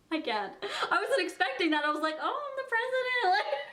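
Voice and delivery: laughing through the words, pitch jumping up; can't stop giggling while talking. Real, not acted. Laughing at being applauded.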